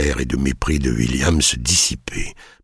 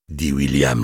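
usual speaking voice

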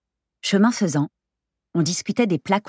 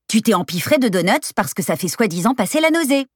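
mocking higher-pitched interjection; indignant interruption; 6 semitones higher, 4 dB louder than usual. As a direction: cut in loudly, pitch climbing. Lola cuts in on an excuse.

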